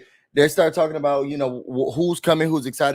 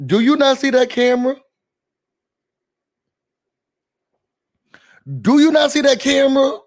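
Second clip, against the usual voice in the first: high, louder incredulous sass; exasperated jab; 9 semitones higher, 4 dB louder than usual. Real, not acted. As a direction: call her out, pitched up high. Exasperated jab at private reality star.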